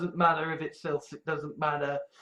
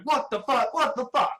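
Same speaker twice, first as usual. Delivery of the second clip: repeated high-pitched disbelief; quick mock-stunned outburst, pitched up; acted shock; 6 semitones higher than usual. Everyone the speaker knew reacting, acted shock.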